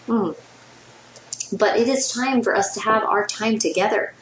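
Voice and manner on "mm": sip of coffee, little hum; sipping a warm drink between words; while sipping a drink. Real, not acted. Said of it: "if you hear me sipping".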